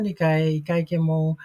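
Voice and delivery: normal talking voice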